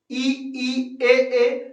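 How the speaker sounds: ई and ए each said twice, clearly; vowel demo. Shown as a demonstration. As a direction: sound out ई, ई, ए, ए for the class. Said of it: "आप एक बार उच्चारण करके".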